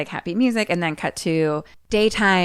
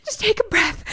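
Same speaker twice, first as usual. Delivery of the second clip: high, urgent mock plea; acted frantic coaxing; desperate pleading; 10 semitones higher than usual. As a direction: beg them to breathe, pitch climbing. Retelling Dad's desperate pleading.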